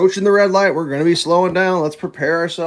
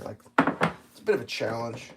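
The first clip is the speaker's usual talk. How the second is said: normal talking voice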